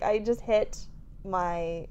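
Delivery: ordinary talk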